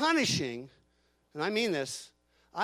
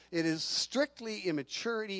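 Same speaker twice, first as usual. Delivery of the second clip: normal talking voice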